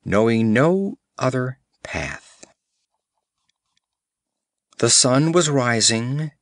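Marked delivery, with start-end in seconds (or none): none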